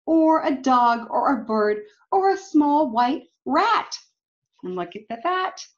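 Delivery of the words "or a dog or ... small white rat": put-on lively storybook read-aloud for kids; reading to children. A narrator's reading. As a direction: read it like a picture book to a class. Reading picture book aloud.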